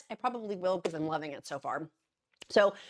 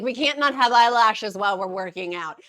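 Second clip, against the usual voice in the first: mock insistence; 3 semitones higher, 14 dB louder than usual. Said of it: "I was like".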